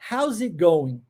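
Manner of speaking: "how's it going?" linked, the S gliding into "it"; relaxed linked "how's it going?" with the T swallowed; linked casual English. Shown as a demonstration. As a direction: run "how's it going?" together like a native. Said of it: "primeiro ponto da união do S com o E".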